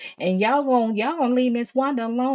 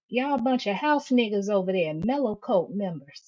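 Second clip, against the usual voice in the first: ordinary talk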